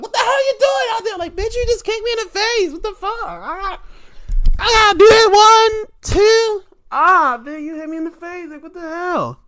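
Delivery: very high, fast, loud squealing complaint; high-pitched outraged wrestler voice; Bianca. Retold or imitated. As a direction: shriek it like a wrestler kicked in the face. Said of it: "Uh, she's like".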